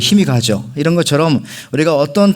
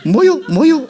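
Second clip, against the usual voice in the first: Chungcheong dog bark; slower than usual. Retold or imitated. Preacher imitating a Chungcheong dog to the congregation, very high bark. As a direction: bark "뭐유" twice like a lazy dog. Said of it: "이렇게 짖는다고 합니다".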